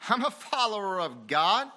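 high, loud imitation of a professing believer; mock proclamation. Preacher voicing a hypocrite to the church, mock proclamation. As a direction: announce it loudly as a hypocrite would.

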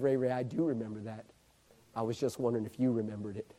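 very low, soft reply; hushed admission. Retold or imitated. Preacher tells Ray Ray he remembers, hushed and low. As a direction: answer quietly, deep down in the voice. Quiet answer retold.

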